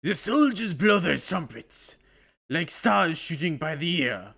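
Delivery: put-on slow, loud, hammy German accent; bad German accent. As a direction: boom it out like a cartoon German officer. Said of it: "hear my bad German accent".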